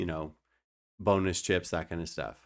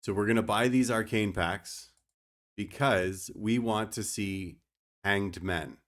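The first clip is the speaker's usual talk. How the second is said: ordinary delivery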